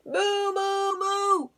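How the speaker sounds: high, loud long moos; mooing. A narrator's reading. Duck tricks the robbers, loud high mooing. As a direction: moo like a duck faking a cow. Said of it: "the duck on the pond said".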